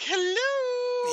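very high, drawn-out silly hello; ridiculous drawn villain. Acted. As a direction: sing it out high like a goofy cartoon figure. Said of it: "he looks ridiculous. He just, he's like".

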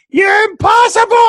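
imitated high, loud scream; screaming to the heavens. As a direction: scream it skyward in exasperation. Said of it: "B J just screams to the heavens".

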